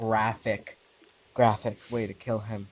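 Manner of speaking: usual speaking voice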